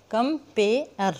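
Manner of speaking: "Compare" slowly split as कम-पे-अर; "compare" drawn out for counting syllables. Shown as a demonstration. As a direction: break "compare" into three separate slow beats. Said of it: "गिन के देखते हैं".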